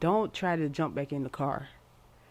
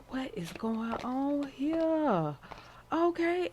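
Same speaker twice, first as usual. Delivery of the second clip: amazed high drawl; 9 semitones higher, much slower than usual. Real, not acted. Amazed at the Strength card.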